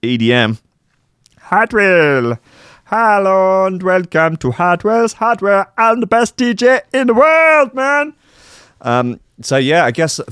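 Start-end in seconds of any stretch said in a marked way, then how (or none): 1.4-8.1 mock announcer. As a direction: ham it up like a shop advert. Parody of a [name] jingle.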